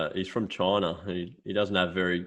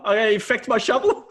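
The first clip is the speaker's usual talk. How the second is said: much higher rising disbelief, voice pushed up; more incredulous; 13 semitones higher, 4 dB louder than usual. Retold or imitated. Old farmer repeats the shovel line, more outraged. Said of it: "He goes".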